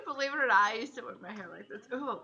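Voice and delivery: ordinary talk, nothing marked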